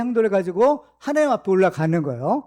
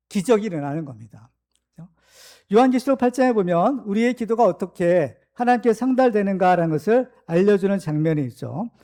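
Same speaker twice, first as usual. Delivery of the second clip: ordinary delivery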